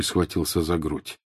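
ordinary delivery, nothing marked

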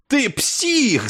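high, furious outburst; fierce yell. A narrator's reading. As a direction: yell it with savage bitterness. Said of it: "заорал Клевенджер с лютым ожесточением".

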